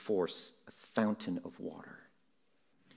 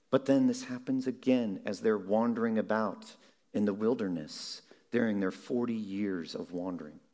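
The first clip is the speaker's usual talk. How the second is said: ordinary talk, nothing marked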